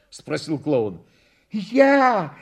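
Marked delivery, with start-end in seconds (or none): 1.5-2.3 high little pupil's answer; proud schoolboy babble. A narrator's reading. Schoolboy owns up to the clown, flushed pride. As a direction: pipe up with shy pride. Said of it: "пролепетал гимназист, вспыхнув от гордости".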